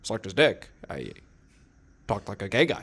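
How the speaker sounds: halting impression with rising phrase ends; Obama. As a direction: break it into hesitant chunks, lifting the last word. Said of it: "Now I talk like Obama".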